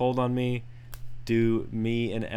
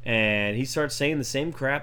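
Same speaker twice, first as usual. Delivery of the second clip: ordinary talk, nothing marked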